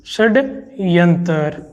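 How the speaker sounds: षड्यंत्र spelled out slowly, syllable by syllable; slow model word. Shown as a demonstration. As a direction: drag out षड्यंत्र for the class. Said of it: "ये शब्द शुद्ध है".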